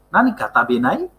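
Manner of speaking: Nanika tabenai? with the voice rising at the end; question rise. Shown as a demonstration. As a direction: ask Nanika tabenai? lifting the last syllable. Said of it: "Intonasinya jadi kalau tanya itu agak". (intonasi naik di akhir pertanyaan)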